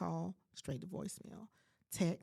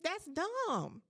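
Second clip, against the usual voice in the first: indignant; 6 semitones higher, slower than usual. Retold or imitated. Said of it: "I'm like".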